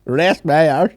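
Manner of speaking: high mock-scornful echo of a dad; mimicked Irish dad. Podcaster echoes dad in the clip, mock scornful.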